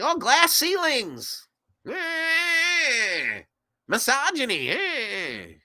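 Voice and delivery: high, drawn-out whiny wail; mock wailing. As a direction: moan each grievance in a mocking, stretched-out whine. Mocking political complaints as wailing.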